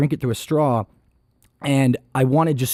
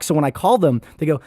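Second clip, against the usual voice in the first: usual speaking voice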